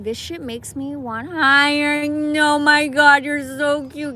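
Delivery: loud, high squeal over a cute animal; gushing. Real, not acted. Squealing at a cute little turkey, delighted.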